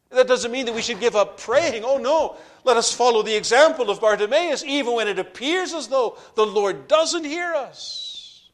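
loud, high, urgent preaching; fervent exhortation. Acted. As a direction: exhort the congregation at full voice. Preaching at full force.

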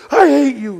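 high mock child yell, I hate you; a pouting kid shouting at a parent; kid's outburst. Parent recalls child yelling at them, high and mock. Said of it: "have you ever had your kid tell you that".

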